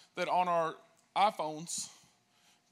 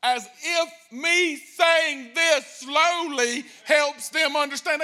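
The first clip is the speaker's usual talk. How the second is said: very slow, loud, higher over-enunciation; exaggerated slow loud foreigner-talk; talking louder and slower; 6 semitones higher, 7 dB louder, much slower than usual. Shown as a demonstration. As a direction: spell it out slowly as if to a foreigner. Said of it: "watch, and slower".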